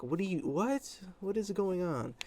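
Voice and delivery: high, baffled what are you; startled disbelief. Retold or imitated. Reacting to the wolf boy on the table, startled and baffled. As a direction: squeak it out in shock.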